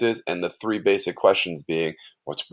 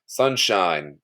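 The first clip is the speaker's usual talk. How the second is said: ordinary talk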